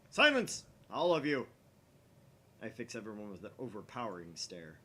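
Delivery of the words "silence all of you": loud, high shout for silence; commanding shout. Acted. Okabe silences the bickering lab, booming. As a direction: bellow it at the whole lab.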